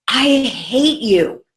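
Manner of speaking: slow, drawn-out angry I hate you; hammed-up furious child; imitating child's angry outburst. Mother retelling daughter's outburst, slow mock anger. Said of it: "she would go".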